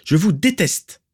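high, hateful outburst; beside herself, crying out. A narrator's reading. Narcissa tells her aunt off, crying out beside herself. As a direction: throw the hatred out in a cry. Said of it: "cria Narcissa hors d'elle".

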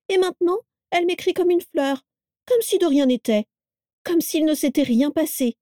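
an indignant young daughter, lightly voiced; young woman voice. A narrator's reading. Daisy voiced by narrator.

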